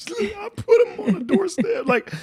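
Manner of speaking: high, laughing, almost crying words; can't get it out for laughing; laughing through it. Real, not acted. Laughing while telling embarrassing story.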